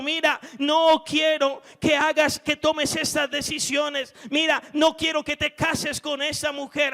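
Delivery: loud, high commanding warning; voice of God. Acted. God warning the believer against that marriage, loud command. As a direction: thunder it down as God forbidding. God's voice warns the listener.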